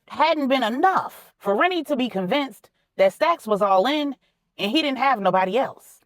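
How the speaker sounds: ordinary delivery, nothing marked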